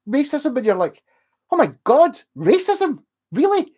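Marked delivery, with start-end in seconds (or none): 1.5-3.7 high, loud incredulous questions; acted astonishment, much higher and louder; shocked disbelief. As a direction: act stunned, pitch leaping up. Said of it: "It's almost a shock".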